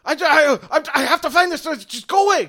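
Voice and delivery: high, loud, panicked snapping; frantic angry outburst. Acted. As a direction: drive the intruder off in a panic. Frantic NPC shooing away player.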